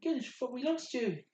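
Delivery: high-pitched relieved exclamation; excited in-character relief. Acted. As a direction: gush with relief in a high pony voice. Pony relieved at friend waking.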